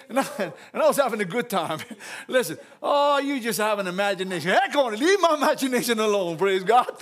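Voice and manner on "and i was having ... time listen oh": ordinary delivery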